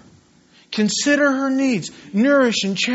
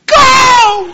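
high, loud cheering yell; excited shout; 14 semitones higher, 9 dB louder than usual. Retold or imitated. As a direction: yell it like a fan on the sideline. Urging wife to cheer loudly.

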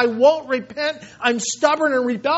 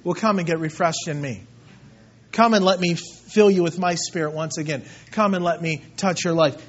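gentle fatherly invitation; 6 semitones lower than usual. Retold or imitated. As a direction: invite them in like a loving father. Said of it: "God the Father says".

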